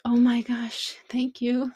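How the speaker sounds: mildly gushing thank-you, reenacted; grateful exclamation. Thanking the man who brought this up, retold gratitude.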